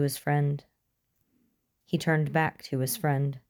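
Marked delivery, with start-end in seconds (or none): none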